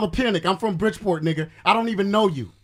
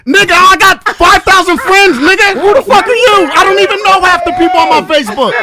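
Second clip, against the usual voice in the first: loud, higher, furious shouting; angry yell; 5 semitones higher, 8 dB louder than usual. Real, not acted. Caller shouts back at woman who insulted him, furious. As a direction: blast her with it. Heated argument on air.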